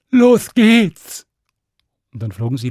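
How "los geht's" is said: loud, high cheer; excited shout. Acted. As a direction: cheer as they take off. Stan cheers at takeoff.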